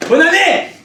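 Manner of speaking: sudden high, loud little cheer; a pitiful bonne année yelled high and loud; mock cheer. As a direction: shout it like a sad village party. Comic punchline, deflated cheer.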